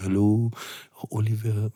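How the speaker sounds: put-on soft, quiet French-accented greeting. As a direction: breathe it gently with a French accent. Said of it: "mit ihrer zarten Stimme, dann diesem lieblichen französischen Akzent".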